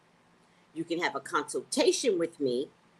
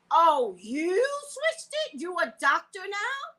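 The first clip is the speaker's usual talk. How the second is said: slow, high sarcastic scoff; mock her for playing doctor; retold sarcastic jab at the pharmacist, high and slow; sarcastic mockery; 13 semitones higher, 3 dB louder, slower than usual. Mocking the pharmacist.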